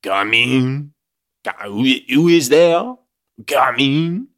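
loud, high, hammed-up French accent; over-the-top cartoon Frenchman calling out. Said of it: "give him a French accent".

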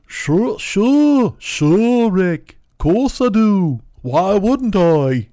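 loud, slow, high cartoon Liverpudlian. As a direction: stretch it out in a bad Liverpool accent. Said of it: "[name]'s like".

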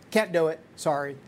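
normal talking voice

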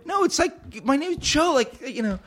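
high, whiny mock complaint; squeaky exasperated protest about his name; exasperated self-quote. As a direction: pitch it up like a fed-up whine. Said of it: "I'm like".

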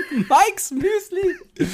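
very high, very slow, giggling brand name; savouring a silly cereal name, laughing high and slow. Real, not acted. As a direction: stretch it out through a giggle.

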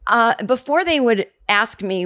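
normal talking voice

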